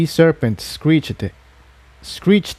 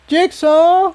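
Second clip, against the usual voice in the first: higher-pitched call into the darkness. Acted. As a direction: shout his name down the stairwell. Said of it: "Incendia yelled".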